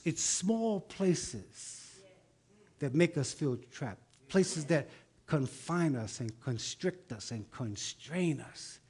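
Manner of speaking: ordinary delivery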